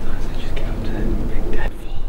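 urgent breathy whisper, voice off; hunting whisper. Real, not acted. Stalking a gobbler, whispering to his hunting partner in hushed urgency. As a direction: whisper so the bird can't hear.

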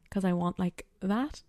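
usual speaking voice

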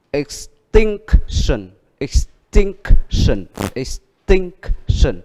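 ex-TINC-tion drawn out slowly, falling; slow teacher model of extinction; stress on tinc. Shown as a demonstration. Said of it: "Vậy âm nhấn ở chữ gì?".

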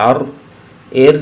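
ordinary talk, nothing marked

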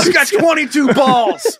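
high, slow drawn-out holler; mock defiant boast. Musket shooter brags about his ammunition, mock defiant boast.

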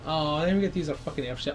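normal talking voice

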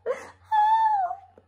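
very high, thin squeal; cry for help. Acted. Second pig as wolf blows, high cry for help. Second pig's house blown down.

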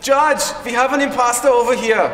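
loud, high call across the hall; indignant calling out. Acted. Hans reports an imposter to the judge, indignant calling out. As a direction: summon the judge loudly.

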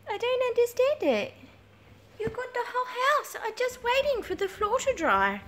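high-pitched, plaintive questioning of a dog; puzzled pet talk. Real, not acted. Owner asking dog why it waits at door, high bafflement. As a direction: ask it high, in fond bafflement.

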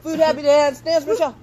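high, loud cheering shout; excited shout. Real, not acted. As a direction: cheer her on to dance. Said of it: "Wanna throw in another happy dance?".

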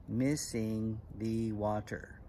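very slow, syllable by syllable; measured haiku recital. Shown as a demonstration. Teacher reciting an example haiku to kids, slow and measured. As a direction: draw out each word for counting.

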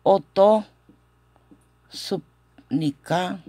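broken into chunks with clear pauses; pronunciation demo. Shown as a demonstration. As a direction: model it slowly, piece by piece. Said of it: "Esta es la pronunciación". (demostración pausada, por partes)

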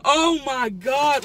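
slow, loud moan of delight; drawn-out delight. Real, not acted. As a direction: stretch it out, savoring. Savoring the bread.

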